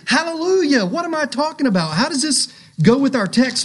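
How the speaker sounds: mock skeptical outburst. Mock skeptic reacting to rejoicing.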